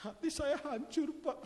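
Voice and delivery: high, slow lament with a rising whine; mock heartbroken. Congregant telling the pastor his heart is broken, lamenting. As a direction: play a heartbroken churchgoer. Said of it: "Nunjuk di tengah".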